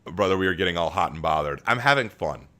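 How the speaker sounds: usual speaking voice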